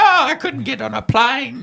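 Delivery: high, far, laughing mock voice; a silly put-on old man voice.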